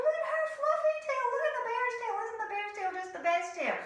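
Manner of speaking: high, loud gushing; high cooing chorus; admiring animals. Acted. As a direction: gush over the bear's tail. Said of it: "everyone was going".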